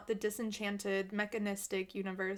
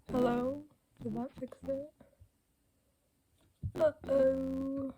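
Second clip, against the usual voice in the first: ordinary delivery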